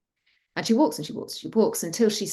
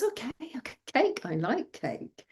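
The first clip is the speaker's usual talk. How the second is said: high, soft acceptance sinking softer; coy daughter; 6 semitones higher, 6 dB quieter than usual. A narrator's reading. Daughter to father offering food, coyly pleased. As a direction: give in softly to a treat.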